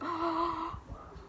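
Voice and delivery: high, soft gasp of fright; scared gasp. Acted. Girl seeing the monster emerge, scared gasp. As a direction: suck in air in fear. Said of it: "Mohini was scared".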